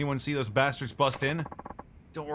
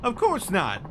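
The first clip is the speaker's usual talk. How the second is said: high, loud, gushing photographer voice; an over-eager paparazzo spotting his big shot; excitable photographer; 7 semitones higher, 6 dB louder than usual. Acted. Mac voice again.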